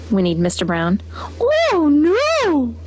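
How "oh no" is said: high, drawn mooed oh no; a cow moans in dismay; mooing dismay. A narrator's reading. Cow Abigail finds she's gone, high moo. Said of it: "mooed Abigail".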